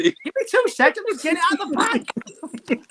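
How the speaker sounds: high, strained while busy with the bag. Real, not acted. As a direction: voice squeezed up while wrestling the bag open. Fumbling burger out of bag.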